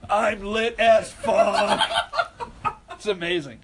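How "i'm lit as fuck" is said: put-on drunk Billy. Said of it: "I'll get Billy".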